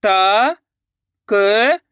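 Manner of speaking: त and क each held long alone; slow, separated त ... क; letter by letter. Shown as a demonstration. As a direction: sound out त, then क, with a gap. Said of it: "एक एक करके".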